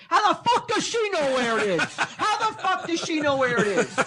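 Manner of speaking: high, laughing outburst of disbelief. Retold or imitated. To the clerk, imagined laughing retort of disbelief. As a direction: laugh it out, incredulous. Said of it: "it was all I could to not be like".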